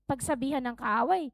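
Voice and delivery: normal talking voice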